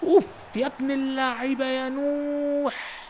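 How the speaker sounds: high, slow, stretched praise; drawn-out goal cheer. Real, not acted. Coach marvels at Noah's goal, stretching each word.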